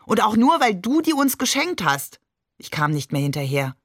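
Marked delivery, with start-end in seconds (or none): 0.0-2.2 higher-pitched, mildly indignant protest; lightly exclaimed retort; indignant call. A narrator's reading. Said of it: "rief Shakir".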